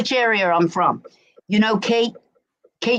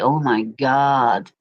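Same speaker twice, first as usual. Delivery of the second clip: exasperated; 8 semitones lower, much slower than usual. Retold or imitated. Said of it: "I'll be like".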